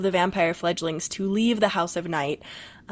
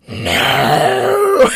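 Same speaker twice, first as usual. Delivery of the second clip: a hint of a demonic caller, louder; scariest voice; 4 semitones higher, 7 dB louder than usual. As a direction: imitate a creepy caller saying no. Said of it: "in the scariest voice I've ever heard".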